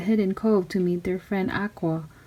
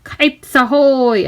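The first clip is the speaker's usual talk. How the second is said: loud, slow and drawn out cry of triumph; triumphant call; 5 semitones higher, 6 dB louder, slower than usual. Acted. Girl sending her kite at the pirates, drawn-out triumphant cry. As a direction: announce it like a captain. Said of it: "I said".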